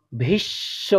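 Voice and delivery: ভীষ্ম without ম; careful ভীষ্ম; pronunciation. Shown as a demonstration. Teacher to pupils, careful example word. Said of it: "অনেকেই এর উচ্চারণ করে থাকে".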